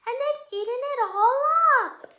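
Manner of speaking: put-on high-pitched storybook baby bear; squeaky Baby Bear. A narrator's reading. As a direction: wail it in a little bear's high squeak. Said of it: "in a squeaky little voice".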